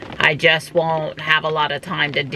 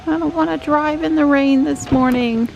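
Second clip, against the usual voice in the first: pet talk; 12 semitones higher than usual. Real, not acted. Talking to cat.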